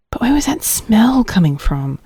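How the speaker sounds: hushed puzzled whisper; whispered wondering. Acted. Louise sneaking into the kitchen, whispering her puzzlement. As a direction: whisper the question to yourself.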